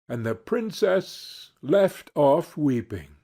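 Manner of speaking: ordinary delivery, nothing marked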